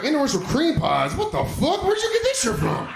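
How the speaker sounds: dad finding his son's browser history, high shocked squeal; shocked disbelief. Retold or imitated. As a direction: voice shooting way up in mock horror.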